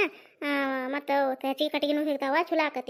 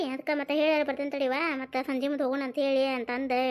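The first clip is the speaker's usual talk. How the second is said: ordinary talk, nothing marked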